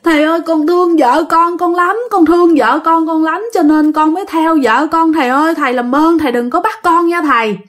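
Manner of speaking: high, wailing imitation of begging; retold spirit pleading with the master; tearful pleading. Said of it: "bắt đầu bật khóc lên".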